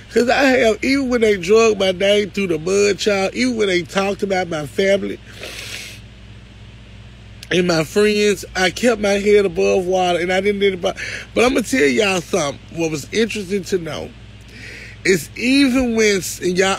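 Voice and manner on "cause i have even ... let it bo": really crying while pushing on; tearful. Real, not acted. Telling followers how people attacked their name and family, tearful. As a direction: testify through tears, voice lifted. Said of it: "I'm about to cry".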